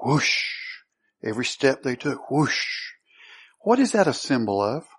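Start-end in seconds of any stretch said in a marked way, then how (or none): 0.0-0.5 airy whoosh with a long hushing tail; imitated branch swish. Preacher showing the sound the branches made, airy imitated whoosh. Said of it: "what sound did these branches make?". 2.3-2.9 soft, airy whoosh; imitated branch swish. Preacher imitating branches at every step, soft whooshing sound. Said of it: "what sound did these branches make?".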